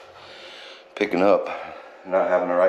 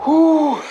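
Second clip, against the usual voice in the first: strained whoop; 8 dB louder than usual. Real, not acted. Strains lifting heavy part.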